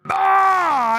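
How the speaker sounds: high, outraged cries of no; outraged shouting. Real, not acted. Streamer yelling at Cutie's clip in outrage. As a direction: yell in mock betrayal at the screen.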